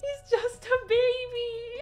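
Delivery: high, slow, gushing coo. Real, not acted. Fan admiring a tiny figurine, cooing.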